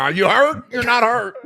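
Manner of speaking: high, loud, hammed-up questioning; mock frantic insistence. Driver questioning the kid for the camera, mock frantic. Same acted questioning, pushed further.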